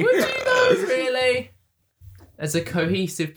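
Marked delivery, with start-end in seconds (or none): 0.0-1.5 high squeak of disbelief; laughing, can you be serious; incredulous. Real, not acted.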